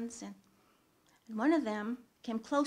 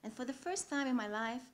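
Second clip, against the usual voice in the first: ordinary talk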